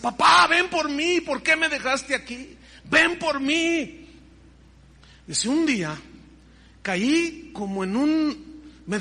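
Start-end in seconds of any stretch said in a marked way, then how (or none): 0.0-4.0 high, slow, begging lament; pleading with father. Retold or imitated. Daughter alone in her room begging her father, slow and high. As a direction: beg the dead father to take her. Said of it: "le decía".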